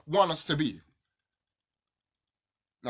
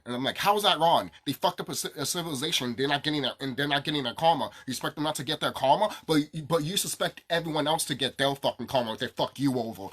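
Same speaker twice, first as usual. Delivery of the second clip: ordinary talk, nothing marked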